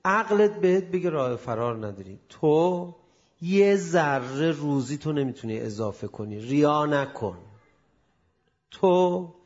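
loud, slow-started forceful sermon; preaching at full force. Acted. Preacher drives the lesson on fate home, loud and forceful. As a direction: hammer the point home to the crowd.

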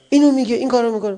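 fast, high, loud list of grievances; exasperated complaint. Acted. Newlywed listing a spouse's faults to the cleric, exasperated. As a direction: rattle off the complaints in mock indignation.

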